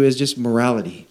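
ordinary talk, nothing marked

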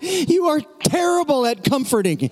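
high, hammed-up outburst; exasperated child. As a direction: wail it with every ounce of soul. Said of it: "In a moment of exasperation".

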